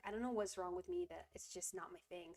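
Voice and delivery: ordinary delivery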